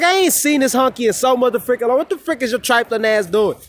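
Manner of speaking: high, loud mock confrontation; retold indignant outburst. Vlogger retelling a run-in with an acquaintance, loud indignant outburst.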